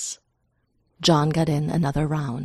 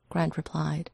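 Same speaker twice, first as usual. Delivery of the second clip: ordinary delivery, nothing marked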